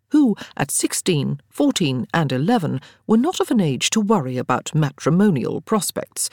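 plain reading voice

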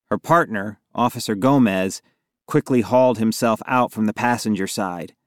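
ordinary delivery, nothing marked

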